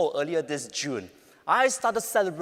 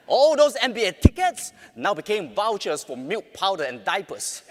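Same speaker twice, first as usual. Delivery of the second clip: ordinary delivery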